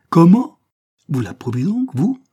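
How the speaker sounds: high, loud rising questions; outraged disbelief. A narrator's reading. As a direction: challenge him in shock. Cornélie shocked that he approves. (stupéfaction indignée)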